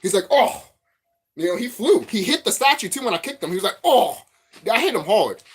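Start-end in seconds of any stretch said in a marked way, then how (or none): 0.3-0.7 high loud oof of a hit monster; sharp high pained oh; mock pained grunt. Reptilian hit by his kick. 3.8-4.2 high loud oof of a hit monster; sharp high pained oh; mock pained grunt. Reptilian hit by his kick.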